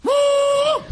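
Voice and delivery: very high, loud shouted hoot; shouted mating call. Retold or imitated. Hunter shouting the mating call a third time, high loud hoot. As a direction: yell the call at full stretch. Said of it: "I did it again, 'Woo!".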